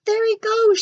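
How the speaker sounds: high, loud shout; spotting the runaway dog. A narrator's reading. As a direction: vice principal shouting. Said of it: "shouted Vice Principal Tanner".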